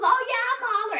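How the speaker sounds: high, easy acted reply; imitated obliging nurse; nurse agreeing. Male nurse answers, retold.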